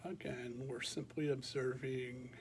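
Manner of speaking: calm, unhurried meditation voice with long pauses; guided meditation. Real, not acted. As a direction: guide listeners gently through a body scan, leaving space after each phrase. Leads a body scan meditation.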